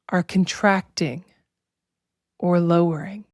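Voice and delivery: slow, measured meditation voice with long pauses; drawn-out, even-toned relaxation guidance; guided meditation. Acted. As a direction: guide listeners unhurriedly into body awareness.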